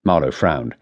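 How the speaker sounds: plain reading voice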